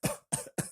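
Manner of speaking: a quick soft cough; mother coughing before she speaks; short cough. Acted. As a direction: clear the throat with a small cough. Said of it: "and coughing".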